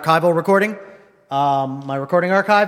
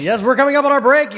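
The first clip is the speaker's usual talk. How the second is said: high, loud, fast announcer echo; radio announcer; 8 semitones higher, 4 dB louder, faster than usual. Real, not acted. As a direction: hype up the break like a DJ. Echoing break in announcer voice.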